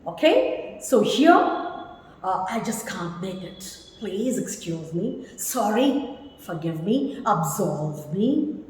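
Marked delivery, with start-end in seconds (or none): 2.5-8.6 soft, low, pleading apology; quiet, courteous excuse; apologetic. Shown as a demonstration. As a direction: beg pardon gently and humbly. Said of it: "the mood of the sentence".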